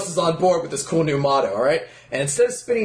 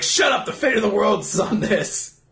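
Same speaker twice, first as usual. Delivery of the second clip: raised-pitch dramatic outburst; mock-angry shout; 5 semitones higher than usual. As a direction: shout it as if the stakes are absurdly high. Voices player snapping at opponent.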